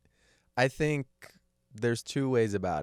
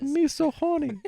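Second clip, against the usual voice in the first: very high, louder mock-Chinese falsetto; mock Chinese accent; 5 dB louder, slower than usual. Host pretends to be a Chinese robot woman, mock-accented falsetto. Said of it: "I was trying to be Chinese".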